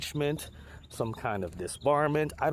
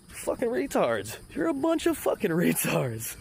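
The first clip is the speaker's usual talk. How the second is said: normal talking voice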